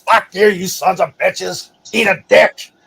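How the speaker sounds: loud, furious tirade, pitch up; angry cursing out. Retold or imitated. Vet yelling at the military, furious shout. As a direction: yell the insults at the brass. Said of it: "That's what I tell them".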